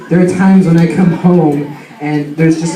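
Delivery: ordinary delivery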